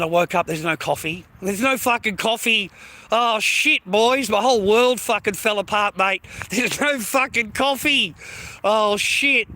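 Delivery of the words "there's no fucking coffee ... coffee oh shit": hammed-up, higher-pitched outrage; comic over-the-top lament; mock despair. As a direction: wail it like the world has ended. Hammed-up lament over missing coffee.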